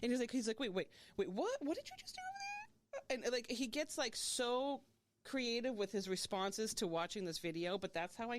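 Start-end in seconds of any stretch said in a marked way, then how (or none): 0.6-3.2 incredulous, pitch leaping very high at the end; animated mock disbelief. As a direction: imitate an animated, baffled onlooker. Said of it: "he's so animated".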